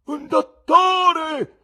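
very slow, high, loud wail; terrible scream. A narrator's reading. Severed head to the whole theatre, terrible drawn-out scream. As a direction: scream desperately for help across a theatre. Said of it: "La testa gridò tremendamente".

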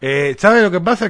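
ordinary talk, nothing marked

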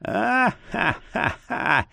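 slow hammed-up drunk laugh; a tipsy merchant's loose guffaw; drunken cackle. A narrator's reading. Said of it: "Торговец рассмеялся".

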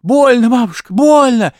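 high, loud cry of pain; girl's loud, high pained cry. A narrator's reading. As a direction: yell that it hurts. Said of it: "Девка, ну, кричать".

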